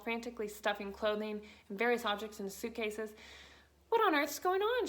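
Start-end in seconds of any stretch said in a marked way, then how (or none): 3.9-4.9 high, loud alarmed cry; cried out. A narrator's reading. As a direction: call out in alarm. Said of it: "she cried".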